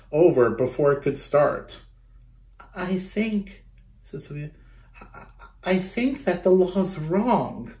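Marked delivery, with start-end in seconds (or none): 2.7-7.7 put-on a shy kid forcing out her words; small second-grade voice. A narrator's reading. Sophia telling the clerk the law is wrong, timid. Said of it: "her second grade voice didn't sound very strong".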